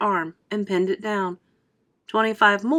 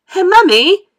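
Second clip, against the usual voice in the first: much higher, loud demanding yell; shout; 10 semitones higher, 13 dB louder than usual. A narrator's reading. Spoilt girl yells for her mother, loud and high. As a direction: yell for Mummy like a spoilt child. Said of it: "shouted Veruca Salt suddenly".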